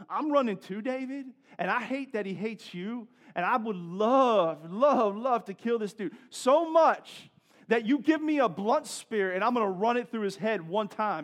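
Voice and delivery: hammed-up fury, building on the repeated words; hot-headed warrior rant. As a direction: act out a loyal fighter itching to kill. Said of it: "What this dude was saying is like".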